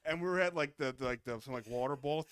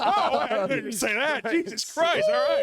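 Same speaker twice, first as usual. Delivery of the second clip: higher and louder, laughing in disbelief, slow; shocked laughing; 11 semitones higher, 9 dB louder, slower than usual. Retold or imitated. Boy reacting to friend's slur, shocked laughter. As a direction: laugh it out, scandalised.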